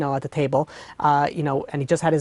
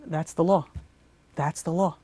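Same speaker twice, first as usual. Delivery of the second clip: softer, head-down murmur; quiet resigned repetition; 2 semitones lower, 6 dB quieter than usual. Acted. Broken judge to himself, a soft resigned murmur. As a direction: say it to yourself, giving in.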